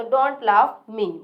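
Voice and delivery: stare at me dragged very slowly; slow modelling. Shown as a demonstration. Teacher slowly modelling stare at me for the class. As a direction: draw each word out for learners. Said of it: "teacher models English slowly".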